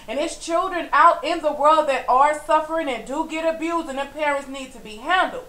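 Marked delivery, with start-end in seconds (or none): none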